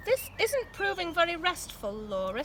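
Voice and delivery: mild crossness. A narrator's reading.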